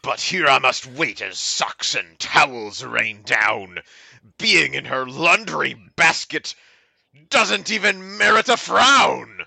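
measured dramatic poetry reading; mock-earnest verse with pauses; poem recital. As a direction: recite it deliberately, phrase by phrase. Reads a poem aloud.

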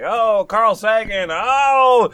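loud, high, gushing fanboy; mock-gush with rising excitement; excited. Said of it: "he was like".

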